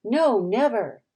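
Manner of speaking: slow, emphatic chorus of agreement; loud, slow exclamation; emphatic cry. A narrator's reading. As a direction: cry it aloud, drawn out. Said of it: "cried aloud".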